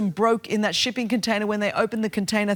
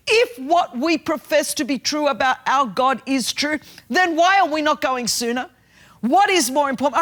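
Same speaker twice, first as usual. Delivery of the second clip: louder, higher pulpit challenge; preaching at full force; 6 semitones higher, 4 dB louder than usual. Acted. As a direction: press the congregation hard.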